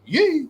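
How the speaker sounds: squeaky high-pitched yay; high yay shout. Real, not acted. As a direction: yelp it out high like a celebration. Signature sign-off yell.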